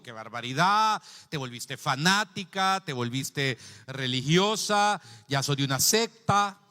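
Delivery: loud, drawn-out mock scolding; retold outrage. Son reproaches his newly converted evangelical mother, retold loud outrage. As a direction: act out scandalized reproach, stretching the accusations.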